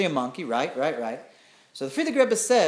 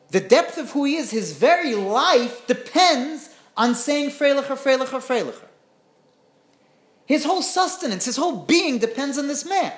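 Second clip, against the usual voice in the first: preaching; 7 semitones higher, 5 dB louder than usual. Acted. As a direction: drive the point home like a sermon. Preaching the lesson with force.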